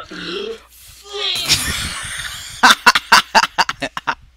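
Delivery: loud howling laugh; bursting into laughter; loud laughing fit. Real, not acted.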